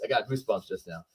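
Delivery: ordinary talk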